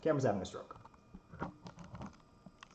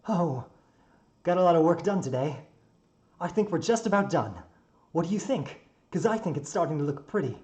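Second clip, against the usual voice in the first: loud, higher-pitched, upbeat character voice; cheerful young builder; 7 semitones higher, 8 dB louder than usual. Acted. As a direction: proudly show off the day's work. Plays Tsukihiko in the story.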